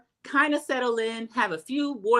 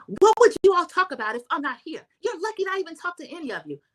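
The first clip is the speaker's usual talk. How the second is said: high, haughty mimicry of a snob; snooty Heather; 9 semitones higher, faster than usual. Vlogger mimics Heather sneering at the ladies, haughty and high. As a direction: toss it off like you're above them all. Imitating Heather's snobbish retort.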